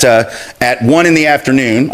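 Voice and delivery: ordinary delivery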